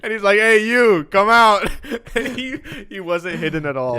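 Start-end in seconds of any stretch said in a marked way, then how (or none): 0.4-1.7 cop calling out. Retold or imitated. Cop calls friend from bush.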